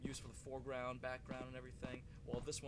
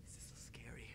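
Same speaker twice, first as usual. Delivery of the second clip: unvoiced, softer whisper; scared whisper; 3 semitones lower, 7 dB quieter than usual. Real, not acted. Gamer admitting fear at the end, whispered. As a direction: breathe it, still spooked.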